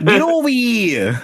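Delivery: put-on slow, high Brooklyn accent; mock a thick Brooklyn accent. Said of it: "his fucking Brooklyn accent".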